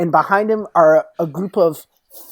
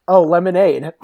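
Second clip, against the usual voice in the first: ordinary talk, nothing marked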